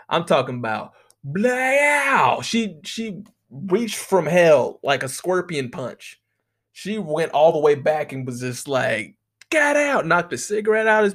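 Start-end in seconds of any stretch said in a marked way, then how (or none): 1.2-2.4 high, drawn-out "blaow" shout; shouted punch sound effect. Retold or imitated. 9.5-10.1 high-pitched yelled smack sound; imitated punch impact.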